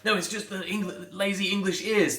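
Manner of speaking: high-pitched mock protest; put-on defensive whine of denial. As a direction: imitate someone making excuses. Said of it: "you're getting defensive and".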